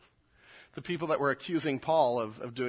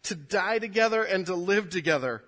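raised, earnest reading of scripture; 6 semitones higher, 6 dB louder than usual. Acted. Pastor reads Paul's letter to his congregation, earnest and raised. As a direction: proclaim the passage to the church.

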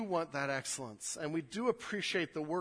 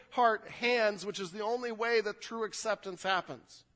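loud, driving preacher voice; raised pulpit delivery, pressing each line; fervent preaching; 8 semitones higher, 7 dB louder than usual. Acted. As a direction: preach it out to the whole room. Preaching the text at full force.

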